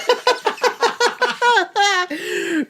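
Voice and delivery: high laughter with tears; laughing fit. Real, not acted. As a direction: break into helpless laughter. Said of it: "laughing at the name".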